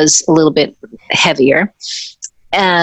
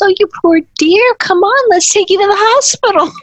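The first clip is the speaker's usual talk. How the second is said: high, cooing mock sympathy; sympathetic. Pat Miri comforting the injured dancer, high cooing sympathy. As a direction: coo over her like a hurt child. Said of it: "She goes".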